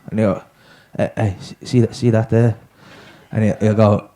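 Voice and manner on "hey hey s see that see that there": low dazed stoner voice; stoned mate. Retold or imitated. Stoned student asking his mate about a colour, low and dazed.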